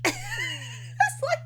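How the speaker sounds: high, breaking laugh through the words; can't stop laughing at it; real giggling disbelief; laughing incredulous. Real, not acted. Laughing hard at the absurd scene.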